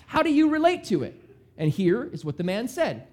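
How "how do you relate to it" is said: higher, quicker probing question; Jesus asking. Retold or imitated. Preacher voicing Jesus quizzing the scholar, higher and faster. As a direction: nudge him to answer. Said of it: "Jesus asked".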